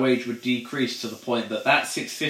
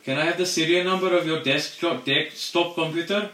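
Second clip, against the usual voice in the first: higher parody of a support agent; mock IT agent; 6 semitones higher than usual. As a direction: rattle off the script like a call-centre agent.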